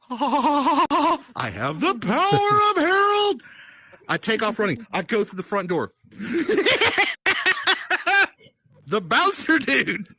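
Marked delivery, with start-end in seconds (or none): 0.1-3.4 high, drawn-out gleeful proclamation with laughter; mock-triumphant. Storyteller boasting after borrowing a pig, mock-triumphant. 6.2-8.3 high-pitched, giddy cackle; gleeful cackle. Acted. Storyteller running into the club with a pig, gleeful cackle.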